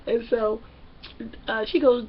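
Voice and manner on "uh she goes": ordinary talk, nothing marked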